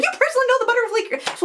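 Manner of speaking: very high incredulous squeal; starstruck amazement. Retold or imitated. Fangirl learns her friend knows the couple, amazed. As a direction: squeak it in excited disbelief.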